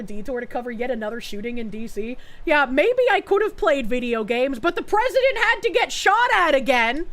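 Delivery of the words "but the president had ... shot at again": mock outrage. Mock self-pity.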